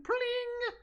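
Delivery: very high ringing sound effect; shining pling. Retold or imitated. Kid disappearing into the ether, high ringing pling. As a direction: chime it like a twinkling star. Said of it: "a shining pling sound".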